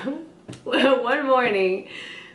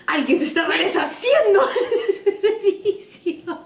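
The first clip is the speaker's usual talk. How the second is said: high-pitched imitation of mom in Spanish; mom's voice; 7 semitones higher than usual. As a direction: mimic mom complaining, breaking into laughter. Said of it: "my mom was like".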